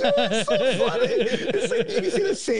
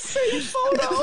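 high, slow, laughing; real laughter, much higher; slower than usual. Real, not acted. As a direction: say it through helpless laughter.

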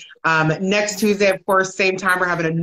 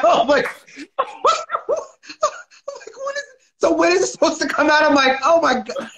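high teary laughing gush; gush, laughing and almost crying; laughing, near tears; 12 semitones higher than usual. Retold or imitated. Host moved by the book title, laughing through tears.

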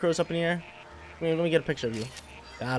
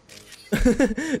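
high laugh; 10 semitones higher, 6 dB louder than usual. Real, not acted. Laughs at Scorbunny.

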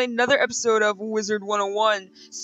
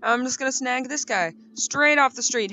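usual speaking voice

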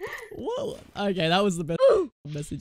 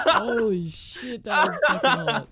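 high, laughing disbelief; 6 semitones higher, 7 dB louder, slower than usual. Real, not acted. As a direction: laugh through the words. Laughing at voice crack.

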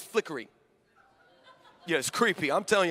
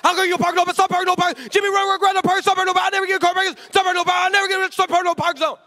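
high, loud frantic babble; hammed-up loud crazy-man rant; gibberish ranting figure; 9 semitones higher, 6 dB louder, faster than usual. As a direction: rant in garbled broken words. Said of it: "this thing talked back".

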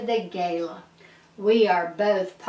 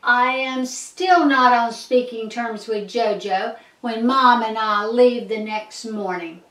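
ordinary delivery, nothing marked

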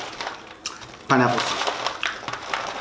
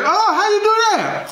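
high falsetto mock curiosity; exaggerated squeaky questioning; impressed caller. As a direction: gush like an amazed friend, pitched way up. Acted caller reacting to cooking.

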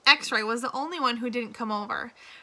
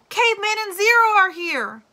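much higher and louder call back into a room; shouting; 10 semitones higher, 8 dB louder than usual. A narrator's reading. Squid calls the other boys from the rec room, excited shout. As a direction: yell it to the boys inside, excited. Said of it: "Squid shouted back into the rec room".

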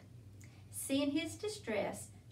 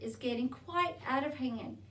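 plain reading voice

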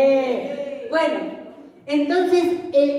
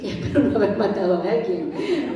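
laughing worry; 3 semitones lower, faster than usual. Retold or imitated. Worried thought retold.

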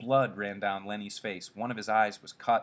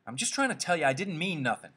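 defensive backing down; 7 semitones higher, faster than usual. Acted. Curly backs down before Slim.

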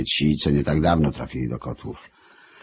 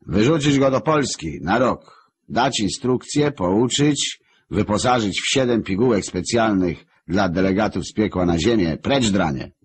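barked orders; 6 semitones higher than usual. Acted. Lucifer orders Marek expelled.

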